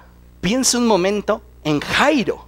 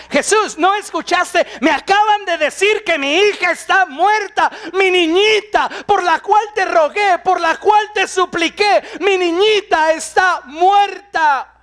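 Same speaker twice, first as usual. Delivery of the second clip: much higher, shouting with a sob; desperate plea; 7 semitones higher than usual. Retold or imitated. The grieving father protesting to Jesus, sobbing shout. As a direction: cry out in anguish. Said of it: "Alguno diría".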